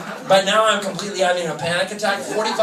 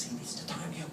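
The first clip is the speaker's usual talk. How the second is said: whispered echo of the order; whispering the order to herself; 17 dB quieter than usual. Retold or imitated. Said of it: "She goes".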